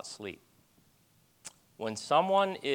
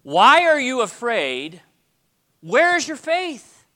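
rebuking; 9 semitones higher, 9 dB louder, slower than usual. Retold or imitated. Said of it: "what he says to them is".

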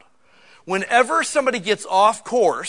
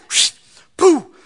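spanking noise; 10 semitones higher, 7 dB louder than usual. Retold or imitated. Imitating the spanking sound.

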